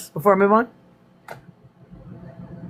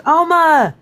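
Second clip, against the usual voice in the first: put-on high, loud kid yelling for grandma; imitated granddaughter's loud, high shout; child hollering; 13 semitones higher, 4 dB louder than usual. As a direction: holler it out like a proud little girl. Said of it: "she hollers".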